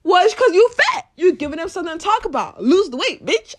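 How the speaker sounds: mild imitated loud put-down; blunt retort. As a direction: hit them with it. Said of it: "And he said".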